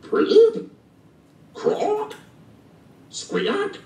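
high-pitched imitated frog noises, each rising; high frog-call imitations. A narrator's reading. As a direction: squeak and croak like a frog. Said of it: "Stink listened to frog calls".

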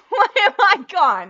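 high, loud, laughing disbelief; laughing panic. Real, not acted. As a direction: shriek it through panicked laughter. Webcam fails, panicked repeat.

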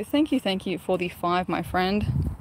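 usual speaking voice

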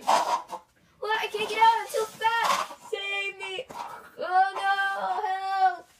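high, loud whiny pleas for rescue; mock panic. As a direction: beg comically to be saved. Fat beaver stuck, begging.

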